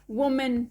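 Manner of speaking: वुमेन said with a clear "men" ending; second syllable of वुमेन rhymes with "pen"; common mispronunciation. Shown as a demonstration. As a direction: end वुमेन on a full "-men". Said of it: "इसे वुमेन नहीं पढ़ा जाता".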